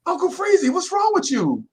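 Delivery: put-on high kid voice asking what's wrong; classmates asking. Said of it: "Dudes is like".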